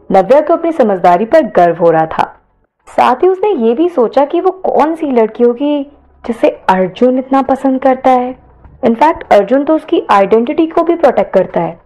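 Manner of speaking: plain reading voice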